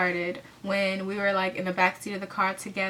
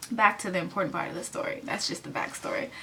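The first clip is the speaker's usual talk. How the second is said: normal talking voice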